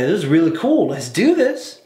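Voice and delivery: retold excitement climbing high; mock eagerness. Podcaster recalls being hooked on the show, rising mock excitement. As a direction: build into a high-pitched, gung-ho cheer. Retelling his eagerness before the twist.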